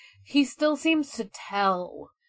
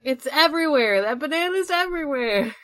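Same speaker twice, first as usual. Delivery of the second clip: higher, louder, delighted outburst; excited; 8 semitones higher, 8 dB louder than usual. Real, not acted. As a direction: gush about the everywhere banana. Excited about the banana.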